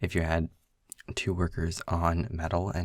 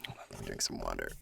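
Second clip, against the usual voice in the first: whispering; 13 semitones lower, 11 dB quieter, faster than usual. Real, not acted. Aside while fetching water.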